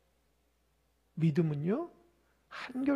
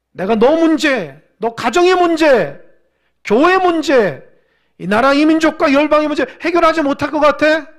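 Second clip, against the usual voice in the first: loud, forceful divine scolding; stern rebuke; 6 semitones higher, 10 dB louder than usual. Acted. Preacher voices God confronting doubters, loud stern rebuke. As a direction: challenge them hard, voice raised and drawn out. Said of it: "따끔하게 얘기합니다".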